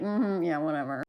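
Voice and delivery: very low, soft, unimpressed mumble; a deep, bored "whatever"; low bored Gizmo. Retold or imitated. Gizmo brushing off his new owners, a low bored mumble. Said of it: "Gizmo's like".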